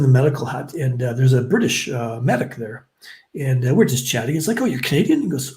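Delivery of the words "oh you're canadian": high-pitched question, imitating someone else; imitated surprise. British medic to a Canadian recruit, mildly surprised. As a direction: act out a curious, high-voiced question.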